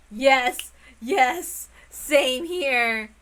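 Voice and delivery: loud eager yells; shouting with excitement; excited cheer. Real, not acted. Excited outburst mid-reading.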